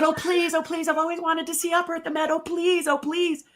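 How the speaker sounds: high, pleading mock whine; begging friend. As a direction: beg like an excited kid. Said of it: "And he said".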